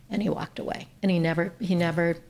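usual speaking voice

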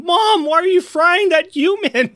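high, childish mock whine; whiny kid. Victor scolding his mother Ultron in a high childish whine. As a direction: play a small kid scolding his mom. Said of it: "it looks like he's saying".

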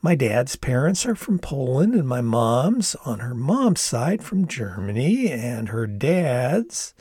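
bouncy singsong with whispered aside; sing-songy mimicry. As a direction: copy his singsong contestant voice. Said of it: "Mimicking his sing-songy voice".

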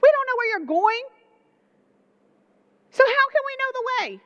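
high, pressing confused questions; Thomas, baffled. Retold or imitated. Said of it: "But Thomas says".

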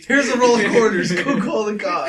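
high, loud mocking order; mocking old man. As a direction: ham up a fussy old man. Mocking Jerry.

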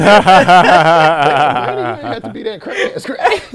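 high-pitched laughing scold; laughing hard. Real, not acted. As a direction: crack up while scolding. Cracking up scolding granny.

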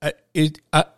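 imitating a stunned friend stammering. Bandmate at the window, too stunned to speak, stammering. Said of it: "he's stammering".

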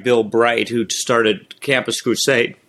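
ordinary talk

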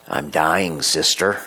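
ordinary talk

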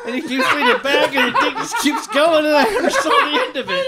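laughing through the words, pitch climbing high; can barely get it out for laughing. Real, not acted. Telling friends the story, cracking up.

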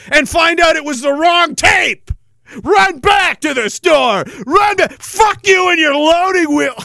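building from grumbling to a loud, high shout; cranky old man rant. Acted.

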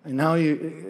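ordinary delivery

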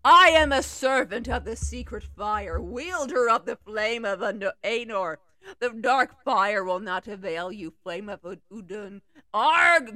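loud, solemn wizard proclamation; commanding wizard. Acted. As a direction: stand firm and forbid passage. Gandalf faces the Balrog.

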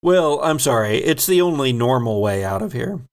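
normal talking voice

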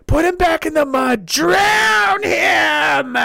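high, drawn-out rallying yell; hammed-up bloodthirsty order; mock mob shout. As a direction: holler it like a mob ringleader. Acting mob leader ordering an attack.

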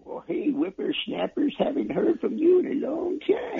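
put-on creaky old-timer voice; lightly voiced old codger; old prospector. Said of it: "Be careful who you call a whippersnapper there, old man".

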